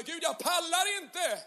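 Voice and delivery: high, slow, drawn-out moan to God; mock exhausted prayer; worn-out plea. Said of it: "ett halvår senare".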